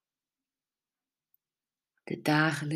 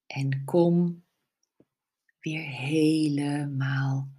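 whispering; 2 semitones lower, 3 dB quieter than usual. Acted. Whispered closing cue.

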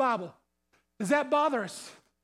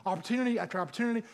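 persistent prayer; 6 semitones lower, 4 dB quieter, faster than usual. Acted. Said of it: "go to God with persistently".

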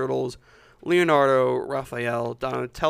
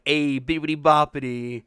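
mock New York cartoon accent, louder; 6 dB louder than usual. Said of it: "generic New York cartoon accent".

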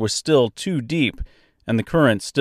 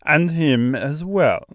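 slow, higher Austrian-French bird voice; Austrian-French accent; 4 semitones higher, slower than usual. A narrator's reading. Stork looking at impaled Mister, in Austrian-French accent. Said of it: "in a strangely thick, almost Austrian accent".

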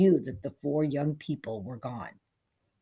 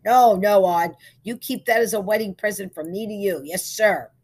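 loud, raised, generous refusal; hearty insistence; 4 semitones higher, 9 dB louder than usual. A narrator's reading. Barber refuses Odd's money for haircut, hearty.

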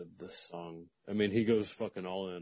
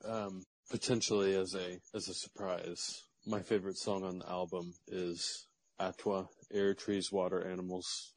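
ordinary delivery

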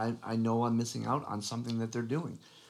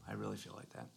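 whispering; 13 semitones lower, 14 dB quieter, faster than usual. Real, not acted. Quiet sincere aside about divorce.

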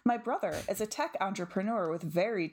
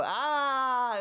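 loud drawn-out ah; 6 semitones higher, 5 dB louder than usual. Retold or imitated. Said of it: "and I just go".

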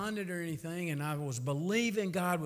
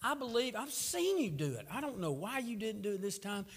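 frustrated, higher, fussing complaint; fussing at God; 6 semitones higher than usual. Retold or imitated. Praying man fusses at God over the boy's death, frustrated and higher. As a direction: vent hurt and anger at God. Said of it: "I'm like".

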